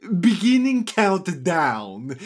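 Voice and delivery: booming, slow countdown announcement; grand riddle-master starts the clock; Sphinx voice. A narrator's reading. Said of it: "he says".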